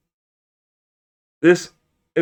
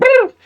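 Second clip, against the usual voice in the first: high laughing squeal; 6 dB louder than usual. Real, not acted. Laughing fit interrupts her.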